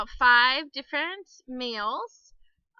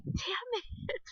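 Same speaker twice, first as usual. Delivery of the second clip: high, soft mock-frustrated curse under the breath; muttered inner curse; 10 semitones higher, 8 dB quieter than usual. Embarrassed woman cursing inwardly at being caught mumbling, mock frustration. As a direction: squeeze out a small, high, reluctant curse. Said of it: "In my head, I'm thinking".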